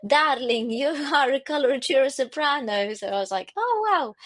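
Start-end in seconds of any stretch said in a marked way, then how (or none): 0.1-2.9 higher-pitched imitation of an Eastern European accent. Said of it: "Eastern European accent". 3.6-4.1 high, falling "oh" of wonder; amazed. Retold or imitated. Quotes own amazed reaction.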